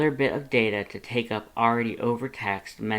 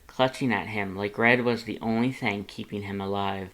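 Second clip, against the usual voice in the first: ordinary delivery, nothing marked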